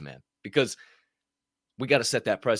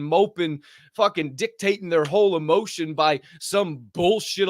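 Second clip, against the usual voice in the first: preachy excitement; 7 semitones higher than usual. Retold or imitated. Lecturing mopers in the locker room, fired up.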